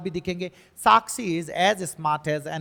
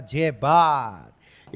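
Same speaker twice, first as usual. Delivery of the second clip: long, loud, stretched-out approval; drawn-out cheer; 3 semitones lower, 5 dB louder, much slower than usual. Real, not acted. Cheers the class on understanding. (खींचकर ज़ोर से शाबाशी)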